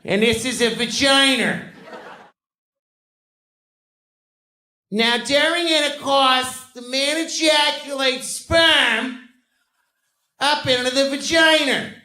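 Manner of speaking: hammed-up teacher building to a shout; fifth grade sex ed teacher. Teacher explains the diagram to the fifth grade, high and building to a shout. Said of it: "She's like".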